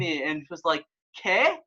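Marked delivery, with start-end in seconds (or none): 1.2-1.6 imitating a baffled girl, high-pitched; mock confused question, pitch shooting up. Girl's baffled reply, acted out.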